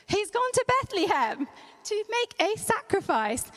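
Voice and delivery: reciting the rehearsed line, pleased with himself; a hint of a smug schoolboy; proud recital. [name] proudly recites his line.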